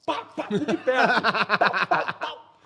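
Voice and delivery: rapid-fire vocal gunshot bangs; imitated gunshots. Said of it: "foi assim, ó".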